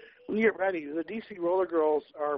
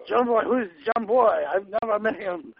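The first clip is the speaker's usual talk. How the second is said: louder, higher mock French accent; fake French accent; 3 semitones higher, 6 dB louder than usual. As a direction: play dumb in a put-on French accent. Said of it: "fucking with you in a French accent".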